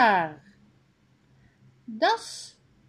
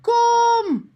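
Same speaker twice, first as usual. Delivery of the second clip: loud high call across the street; high, loud call of "Kom!"; 7 dB louder than usual. A narrator's reading. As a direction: shout it to someone by the bus. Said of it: "Daan roept".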